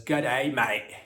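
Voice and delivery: a loud, hammed-up Australian "G'day, mate"; Australian greeting. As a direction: boom it out like a cartoon Aussie. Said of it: "a python that says".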